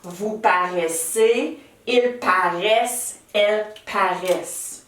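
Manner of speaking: each plural form said slowly and clearly; teacher modelling the conjugation for pupils; slow conjugation drill. Shown as a demonstration. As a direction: recite the plural forms slowly. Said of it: "je parais".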